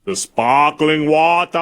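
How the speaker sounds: slow, booming giant voice; drawn-out giant impression; Andre the Giant. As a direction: put on a big lumbering accented voice. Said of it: "I'm gonna get Andre the Giant".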